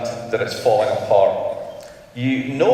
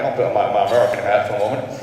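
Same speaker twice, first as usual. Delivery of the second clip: fast, put-on American accent, self-mocking; mock American accent, played for a laugh; bad American accent; much faster than usual. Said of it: "that was my really bad American accent".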